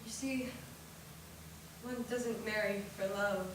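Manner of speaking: soft, low, gentle sisterly voice; tender advice. Acted. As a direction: counsel her softly as a loving sister. Older sister gently advises.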